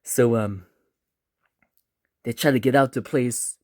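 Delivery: ordinary delivery, nothing marked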